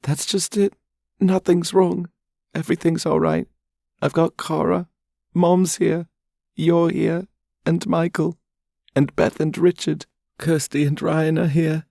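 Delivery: high, loud, crying voice counting her blessings; tearful young woman. Acted. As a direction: weep through the happy list. Charlotte's tearful voice.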